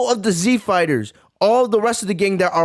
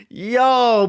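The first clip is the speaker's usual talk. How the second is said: ordinary talk, nothing marked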